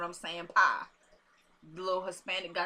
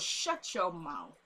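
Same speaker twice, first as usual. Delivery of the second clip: slow, softer retold command; imitated drawn-out put-down; 3 semitones lower, 5 dB quieter, much slower than usual. Woman shutting Julius up, slowly and deliberately. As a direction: stretch each word out to silence him.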